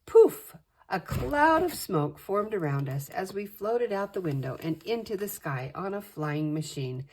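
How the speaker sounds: usual speaking voice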